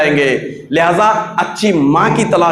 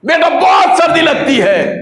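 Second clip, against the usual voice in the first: put-on old man shouting; 8 semitones higher than usual. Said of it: "फिर बूढ़ा चिल्लाया".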